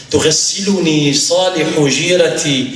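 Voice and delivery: high, loud recitation and urgent narration; dying man's plea, delivered loud and high; impassioned preaching. Acted. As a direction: preach at full force, voice lifted throughout.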